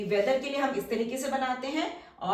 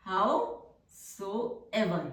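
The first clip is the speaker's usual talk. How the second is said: very drawn-out, syllable by syllable; slow dictation; 2 semitones lower, much slower than usual. Shown as a demonstration. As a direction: stretch it out for the class to write. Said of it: "teacher dictates word slowly". (बहुत धीमे खींचकर बोलना)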